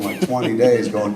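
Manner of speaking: ordinary talk, nothing marked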